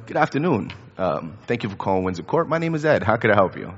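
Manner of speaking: polite, lightly robotic professional phone greeting; work phone voice. Retold or imitated. Dad answers a customer's call at work, lightly put-on phone voice. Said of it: "at the way I was talking on the phone".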